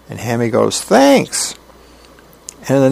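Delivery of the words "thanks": very high, chirpy voice; pleased child. Acted. Hammy thanking Mom for praise, delighted. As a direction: squeak it like a delighted kid.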